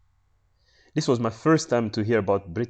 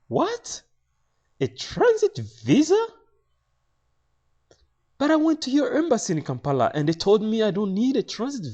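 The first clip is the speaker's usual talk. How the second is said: high, loud disbelief; incredulous, voice shooting up high; shocked protest; 7 dB louder than usual. Retold or imitated. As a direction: protest in pitched-up astonishment. Acts out his shocked protest.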